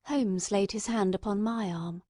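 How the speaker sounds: usual speaking voice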